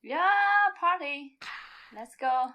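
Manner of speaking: very loud shouted party cheer ending in a laughing woo. Acted. Party girl yelling to start the night, laughing loud. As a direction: yell it to the whole street.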